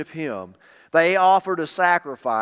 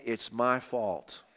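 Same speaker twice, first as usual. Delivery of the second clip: softer, slow It's my fault with weight on my; quiet confession; 3 semitones lower, 7 dB quieter, slower than usual. Shown as a demonstration. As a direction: admit It's my fault softly, slowly. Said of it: "the moment that you say".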